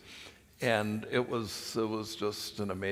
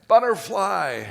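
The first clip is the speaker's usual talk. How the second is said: loud, high holler; yelled out; 9 semitones higher, 10 dB louder than usual. Retold or imitated. Next guy assigning a nickname, loud high yell. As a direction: call it out across a campfire. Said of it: "somebody would yell out".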